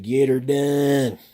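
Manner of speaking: imitating the comic's battle cry, rising to loud; Larry the Cable Guy catchphrase. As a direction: build the catchphrase to a crowd-pleasing shout. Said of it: "anytime he said".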